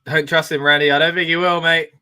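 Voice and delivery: ordinary talk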